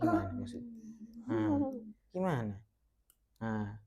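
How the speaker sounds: ordinary delivery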